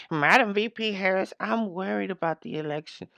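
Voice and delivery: lightly parroting her sing-song line; mocking impression. Commentator parroting the skit host's worried plea, mocking. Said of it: "Look at her".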